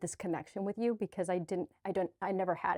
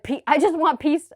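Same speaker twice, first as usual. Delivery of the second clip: much higher and louder, a desperate plea; exasperated outburst for peace; pleading for peace; 8 semitones higher, 11 dB louder than usual. Retold or imitated. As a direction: cry out for peace like you've had enough. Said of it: "I was like".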